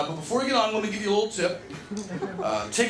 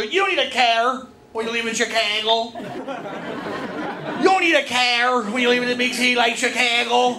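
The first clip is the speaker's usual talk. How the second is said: exaggerated Chicago accent with flattened vowels. Chicago local dismissing the need for a car, mock Chicago accent. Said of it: "when people in Chicago go".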